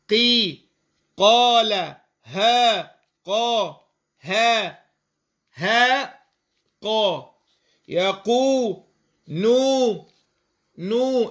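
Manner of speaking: قي قال ها قا يقو نو مقطعًا مقطعًا بالمد; انطق المقاطع منفصلة ممدودة; مقاطع ممدودة. Shown as a demonstration. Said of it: "وساكن وقبله مكسور". (تعداد مقاطع المد)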